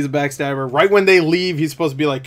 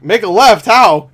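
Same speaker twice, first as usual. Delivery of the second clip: loud, higher, incredulous echo; baffled question; 5 semitones higher, 8 dB louder, slower than usual. Retold or imitated. Tom Cruise questioning Benji's impossible directions, baffled question. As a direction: throw the order back as a question.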